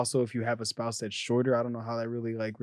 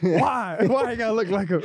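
high, wailing question; wailing uncle; 9 semitones higher than usual. Pretend. Uncle wails at nephew for looking like his father. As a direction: wail at the boy in mock grief.

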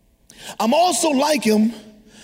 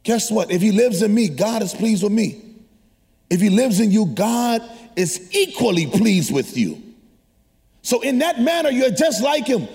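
preaching; 6 semitones higher than usual. Acted. Preaching rises again.

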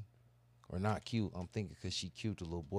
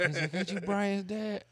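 laughing quote; 11 semitones higher, 5 dB louder than usual. Retold or imitated. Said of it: "I'm like".

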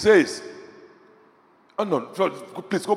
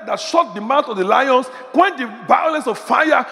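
fervent pulpit preaching; full-force preaching; 5 semitones higher than usual. Real, not acted. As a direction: preach it out, building to a roar. Preaching builds to climax.

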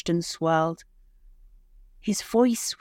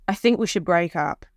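cold husband voice. Acted. Husband ends relationship.

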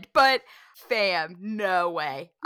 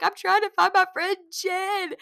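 higher, pleading to a haunted house actor; desperate plea; 10 semitones higher, 4 dB louder than usual. Retold or imitated. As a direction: beg to be let through to find Jen. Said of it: "And she's like".

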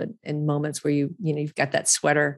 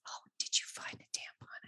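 whisper; 17 dB quieter than usual. Retold or imitated. Boyfriend's hushed reply, voiced in story.

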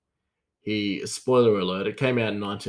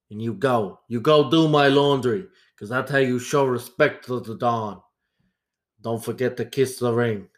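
commanding mafia boss impression; Don Corleone. Boss ordering a young apprentice to do laundry, imperious mafia don. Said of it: "Hang on, I'll do a Don Corleone".

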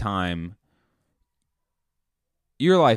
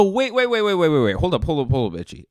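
exasperated interrupting; 7 semitones higher, 5 dB louder, much faster than usual. Retold or imitated. Comic interruption of Itchy.

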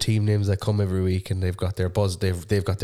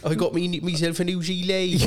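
higher put-on West Country accent; mock Devon farmer; 9 semitones higher than usual. Friend plays along with the farmer joke, mock Devon accent. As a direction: boast about the new gilet like a Devon farmer. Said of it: "Devon farmer".

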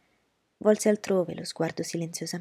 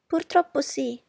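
higher, louder fussy mother; fussy society mother, higher voice; Mrs Bennet's voice; 9 semitones higher, 5 dB louder, slower than usual. Acted. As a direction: play the anxious mother.

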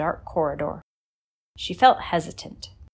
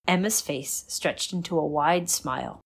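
ordinary delivery

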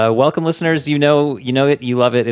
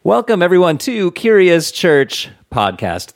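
bright host welcome; 8 semitones higher, 4 dB louder than usual. Shown as a demonstration. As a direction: open the show with energy. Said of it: "Let me try that again".

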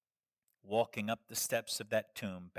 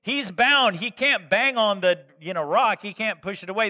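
excited; 9 semitones higher, 14 dB louder than usual. Real, not acted. Excited retelling after mummy joke.

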